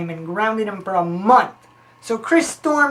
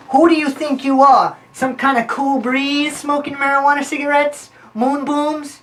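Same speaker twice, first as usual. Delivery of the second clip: mock angry dad; 5 semitones higher, 3 dB louder than usual.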